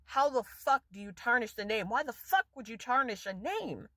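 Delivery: ordinary talk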